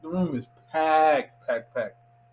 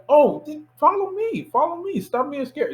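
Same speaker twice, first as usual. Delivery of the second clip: lady's voice; 12 semitones higher than usual. Retold or imitated. Helpful lady voiced in story.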